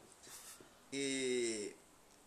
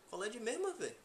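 Bahian accent; 8 semitones higher, 3 dB quieter than usual. Shown as a demonstration. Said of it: "você fala assim".

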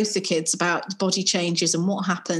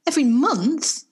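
put-on high, stretched-out disbelief of a child; a daughter aghast at the news; shocked little girl; 8 semitones higher than usual.

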